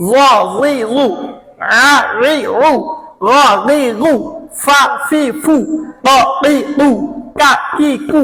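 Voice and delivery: very slow, stretched Arabic syllables; teacher stretches each Arabic syllable for the class; drawn-out recitation. Shown as a demonstration. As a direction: draw each sound out long like tajweed practice.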